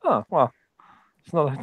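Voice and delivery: normal talking voice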